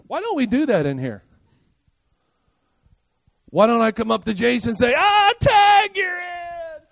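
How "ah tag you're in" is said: playground shout. Retold or imitated. Pastor mimics playground tag.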